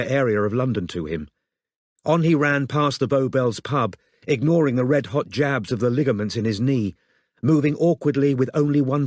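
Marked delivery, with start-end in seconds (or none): none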